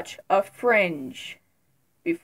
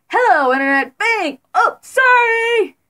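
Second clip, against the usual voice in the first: high, loud mock intro then fake apology; mock Dan greeting; 12 semitones higher, 7 dB louder than usual. Teasing Dan about his old videos, hammed-up mock intro. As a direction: ham up a youtuber greeting gone wrong. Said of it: "going to shoot someone?".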